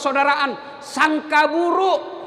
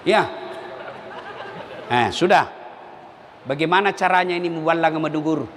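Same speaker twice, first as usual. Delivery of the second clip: normal talking voice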